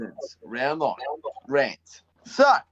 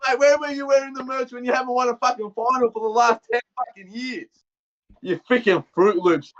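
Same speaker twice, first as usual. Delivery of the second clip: raised pitch, worked-up fan rant; heated rant; 7 semitones higher than usual. Real, not acted. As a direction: let rip at the rival fans, voice up high. Ranting at Essendon fans.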